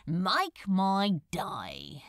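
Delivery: slow, dry bitter line. A narrator's reading. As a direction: drag it out bitterly. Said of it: "he said bitterly".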